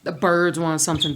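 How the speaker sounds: normal talking voice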